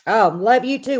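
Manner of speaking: ordinary delivery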